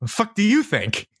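high, mock-indignant kid retort; bratty kid snapping back. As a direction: fire it back like a cocky teenager. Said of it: "And the kid goes".

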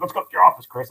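high, loud imitation of an excited coworker; eager urging. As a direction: pitch it up, mock-eagerly urging someone along. Retold eager urging by Carrie.

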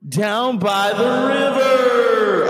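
high, loud, slow sung-out shout; hammed-up Farley bellow; Farley callback. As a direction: stretch it out like the Farley rant.

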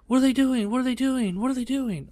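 high panicky mom voice repeating herself. Retold or imitated. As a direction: imitate mom nervously asking, high. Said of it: "Mom is like".

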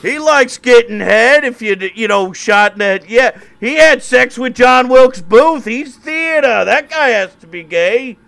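put-on very high, loud, slow heckling shout of a drunk hooligan; Irish hooligan heckler. Time-traveling hooligan heckling Lincoln at the debate, shrill shouting. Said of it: "Irish hooligans".

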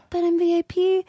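high-pitched retold protest; mock pleading. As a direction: plead like a turned-away guest. Retold plea to gatekeeper.

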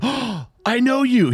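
imitating a second fan, high and slowed; excited recognition. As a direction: gasp out the recognition in a mock squeaky voice. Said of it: "He goes".

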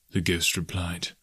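ordinary delivery

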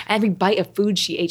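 ordinary talk

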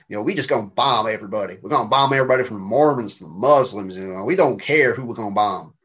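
mimicked Southern American cabbie accent; mock-Southern American taxi driver. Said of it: "and he was like".

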